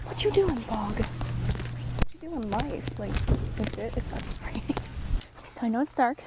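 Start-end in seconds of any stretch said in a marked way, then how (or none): 0.1-0.9 playful mock question. Real, not acted. Talking to the fog playfully. 1.0-3.0 slow playful drawl. Real, not acted. Slow drawn repeat to life.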